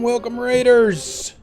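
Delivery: high, slow, loud grand welcome; announcer welcome. Pretend. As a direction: greet them like a showman. Greeting raiders grandly.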